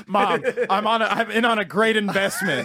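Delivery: loud, high pitch to mother; hammed-up son selling a scheme, laughing; pleading gambling son. Jobless son pitching gambling to his mom, loud mock pleading.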